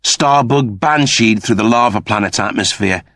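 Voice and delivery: ordinary delivery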